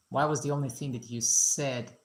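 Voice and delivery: ordinary delivery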